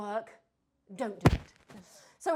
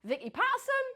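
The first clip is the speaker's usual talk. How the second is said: a hint of Geordie, higher and louder; Geordie accent; 12 semitones higher, 11 dB louder than usual. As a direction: lightly mimic a Geordie TV star. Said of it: "I'll just copy her voice".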